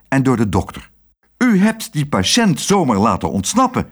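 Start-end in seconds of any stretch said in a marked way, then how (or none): 1.4-3.9 higher-pitched, indignant accusation; mildly cross reproach; angry. A narrator's reading. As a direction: scold her as the angry director. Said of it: "zei de directeur boos".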